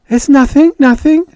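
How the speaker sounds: high, loud, rushed denial; Judy covering up on the radio, babbling high and loud. Acted. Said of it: "Judy babbled".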